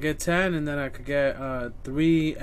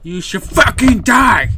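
furious yell, much louder; angry outburst; 2 semitones higher, 12 dB louder than usual. Real, not acted. Streamer yelling at the patient who won't die, furious. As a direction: explode at the screen in rage. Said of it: "I got a little upset there".

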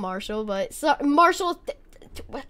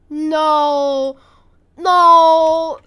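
dismayed cry; 12 dB louder than usual. Real, not acted. Dismay at the game.

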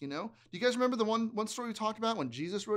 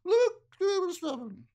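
high, louder mermaid trill; 11 semitones higher, 8 dB louder, slower than usual. Acted. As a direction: belt it like a mermaid. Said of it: "A mermaid voice".

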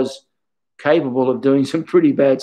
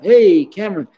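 bright, raised mock greeting calling out a name; cheery greeting; 6 semitones higher, 5 dB louder than usual. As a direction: call out a name like a cheerful old friend. Retold greeting from old teacher.

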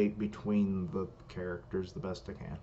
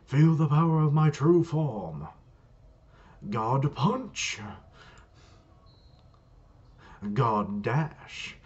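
loud, commanding attack calls; battling god figure; 4 semitones higher, 6 dB louder, slower than usual. Acted. Dimple calling out attacks in battle, booming boast. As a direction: proclaim each attack like a powerful god.

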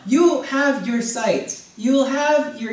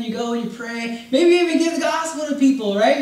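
ordinary delivery

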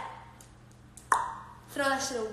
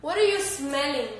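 ordinary talk

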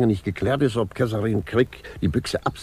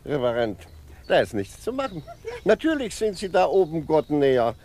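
ordinary delivery

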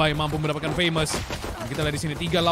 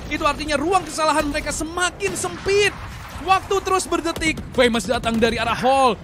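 building hype, higher and louder; fighting game announcer; 10 semitones higher, 5 dB louder than usual. Acted. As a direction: push the tension up line by line. Clutch round, casting builds.

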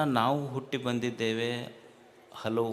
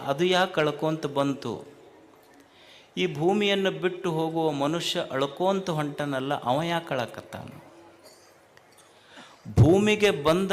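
loud, raised sermon voice; forceful preaching; 3 semitones higher, 7 dB louder than usual. Real, not acted. Preacher asking the congregation why newborn and dying weep, forceful preaching. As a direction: drive each rhetorical question out to the crowd.

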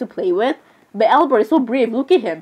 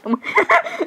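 short, high-pitched burst of laughter; high giggle. Real, not acted. Gamer amused by the others' chat, high giggle. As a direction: break into a quick high giggle. Reacting to the other players' talk.

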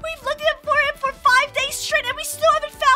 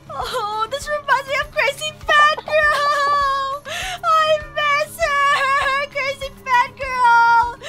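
slow, drawn-out mock weeping; tearful wailing; much slower than usual. Mother reminded of her dead friend, acted wailing. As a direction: stretch the words into a hammed-up wail.

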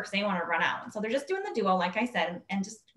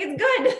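higher and louder firm reassurance; emphatic; 11 semitones higher, 7 dB louder than usual. Retold or imitated. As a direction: insist it is good. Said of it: "He's like".

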